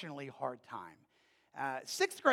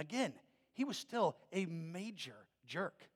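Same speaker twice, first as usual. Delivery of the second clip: ordinary delivery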